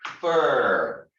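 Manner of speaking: prefer with FER loud and stretched; stress on second syllable. Shown as a demonstration. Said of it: "second syllable".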